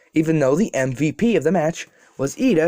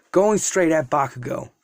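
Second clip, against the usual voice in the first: usual speaking voice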